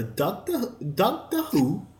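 high-pitched, tentative British accent. Shown as a demonstration. Friend put on the spot to say Doctor Who, shaky British accent attempt. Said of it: "wanna do a British accent again".

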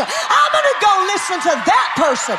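a hint of the excited sinner inside the loud preaching; mock fleshly self. Pastor voicing the flesh's glee, preached loud. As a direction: cry it like the flesh finding an excuse. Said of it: "that says".

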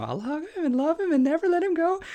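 high squeaky cartoon-girl voice; cartoon little girl. Preacher imitating a clingy cartoon girl, high squeaky voice. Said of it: "like that little girl on the Animaniacs".

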